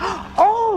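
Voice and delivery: sharp high gasp of surprise; startled gasp. Acted. Karen startled by Eve in the alley, gasping. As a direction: catch your breath, startled.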